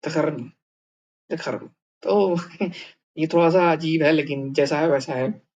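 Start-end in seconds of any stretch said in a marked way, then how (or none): 0.0-1.7 धर्म softly with d and kh merged; quiet d-kh blend. Shown as a demonstration. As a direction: model धर्म quietly the Russian way. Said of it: "ये द, ध बन गया है".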